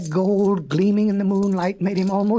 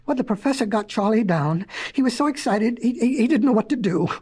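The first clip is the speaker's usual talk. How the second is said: plain reading voice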